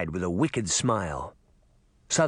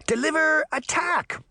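battle cry; 14 semitones higher than usual. Acted. Girl shouting a karate move.

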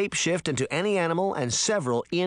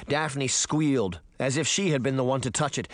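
plain reading voice

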